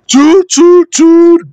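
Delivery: loud high horn blasts; bellowed toot. Acted. Bertie bellowing his horn to stop Edward. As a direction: blast the horn to hold the train. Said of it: "bellowed Bertie".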